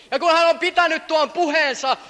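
normal talking voice

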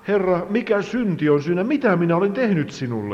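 high, pleading question to God; retold inner cry. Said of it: "Minä sisäisesti huusin". (kerrottu hätääntynyt huuto Jumalalle)

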